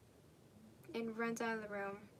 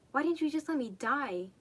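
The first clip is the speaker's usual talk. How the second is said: louder, despairing cry; anguished outburst; 4 semitones higher, 8 dB louder than usual. Acted. A child yelling at her father in despair. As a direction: hurl it at him in despair.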